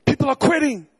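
high, loud full-force preaching. Acted. Pastor warning his church family, preaching at full force. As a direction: warn the church with rising fervor.

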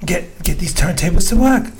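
a lightly put-on British accent, half laughing; doing the British DJ in a playful British accent. Telling friends how the British DJ said it, in a light British accent. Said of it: "I'm trying to do my British accent".

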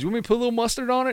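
rushed, high mock hillbilly accent. Disguised pitcher teasing the batter, fast high hillbilly accent. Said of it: "like, hillbilly accent".

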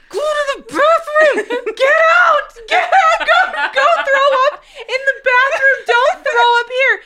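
high, loud frantic yell; yelling at her sister. Retold or imitated. Big sister yelling at vomiting Lainey, frantic shout. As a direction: shout her out of the bed, panicked.

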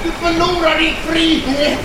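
loud, high yell; pleading shout. Acted. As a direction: shout it at her. Prisoner shouts argument.

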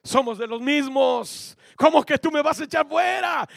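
demon taunting. Retold or imitated. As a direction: sneer back at the would-be exorcist. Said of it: "Y le dijo".

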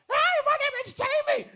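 put-on very high, loud squawky girl voice; Jamie's shrill voice. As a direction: squawk it like the odd girl from school. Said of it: "she had a voice that".

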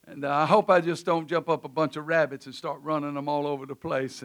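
ordinary talk, nothing marked

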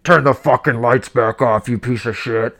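hint of a hacker threatening over the camera; creepy stranger voice. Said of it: "they hear a voice that's like".

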